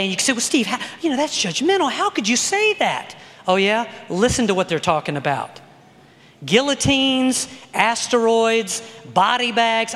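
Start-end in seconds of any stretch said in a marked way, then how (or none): 0.3-3.2 mock indignant objection. 6.4-9.9 slow, drawn-out list of horrors; derisive list. Real, not acted. Lists doom topics as laughable.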